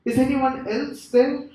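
high, loud hammed-up shout; crying out for help. Man on the branch seeks a second opinion, high shouting. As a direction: call desperately for some other rescuer. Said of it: "John cries out".

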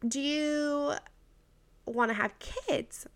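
mildly cautious, careful question; tiptoey doctor. Retold or imitated. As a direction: ask gingerly, tiptoeing around it. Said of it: "Very, like, kind of tiptoey".

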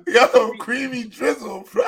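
slow, amused, with laughter; laughing. Real, not acted. Host repeating the guest's silly name, laughing. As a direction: drag the name out through a laugh.

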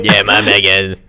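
put-on botched southern accent; put-on southern rapper voice.

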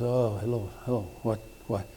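low, soft groggy phone answer. Retold or imitated. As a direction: mumble it like you just got woken up. Woken by a midnight phone call.

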